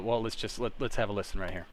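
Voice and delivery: usual speaking voice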